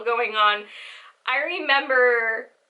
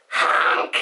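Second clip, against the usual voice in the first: breath-heavy, voiceless mock honk; imitated honk. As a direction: honk like a silly horn without voice. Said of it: "And I just go".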